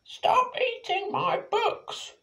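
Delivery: high, slow, shrill-edged yell; annoyed librarian. Acted. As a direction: chase them out of the library. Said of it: "She chased Penny and Og out".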